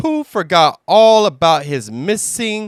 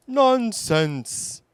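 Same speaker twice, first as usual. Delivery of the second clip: Pooh voice; 8 semitones higher than usual. Acted.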